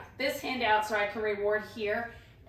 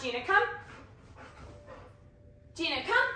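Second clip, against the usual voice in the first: commanding a dog; 8 semitones higher than usual. Real, not acted. Recall command.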